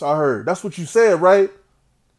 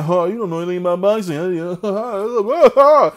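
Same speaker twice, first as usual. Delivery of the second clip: mock retweet voice. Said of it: "retweeting somebody saying".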